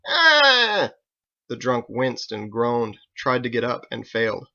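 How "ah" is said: loud high cry of pain; pained yelp. Acted. Drunk kicked in the leg, loud pained yelp. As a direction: yelp as the boot lands.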